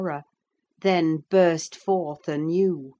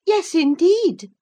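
reproving servant; 14 semitones higher, 9 dB louder than usual. Acted. Servant confirms he is her cousin, reproving. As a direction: confirm it firmly, voice raised. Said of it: "responded her reprover".

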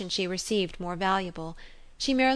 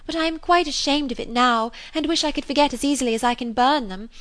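higher, emphatic confession; ashamed confession; 6 semitones higher, 3 dB louder than usual. A narrator's reading. Harriet admitting shame to her friend, ashamed and emphatic. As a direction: confess it with rising pitch and energy.

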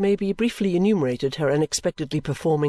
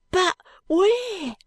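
very high wailing question; the boy begging the constable, crying; desperate cry. A narrator's reading. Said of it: "cries the boy".

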